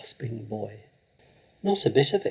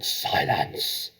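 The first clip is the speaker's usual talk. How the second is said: low, harsh yell; 8 semitones lower than usual. A narrator's reading. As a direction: bark it out. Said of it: "wheeled round and yelled".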